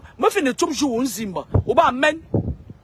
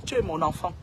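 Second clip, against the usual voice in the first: father to his son, saying it again lower and softer; softer, lower repeat; 7 semitones lower, 7 dB quieter than usual. Real, not acted. Softer, lower second take.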